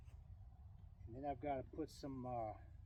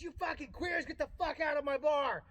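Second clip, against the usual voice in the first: higher, louder furious yell; angry bartender shout; 9 semitones higher, 7 dB louder than usual. Acted. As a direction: throw them out of your bar, screaming. Bartender yelling at the bums.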